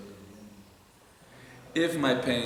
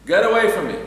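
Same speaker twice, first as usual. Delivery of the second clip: a sudden shout, much higher and louder; shouted rebuke; 11 semitones higher, 13 dB louder than usual. Pretend. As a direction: drive him off with one shout. Rebuking the evil inclination.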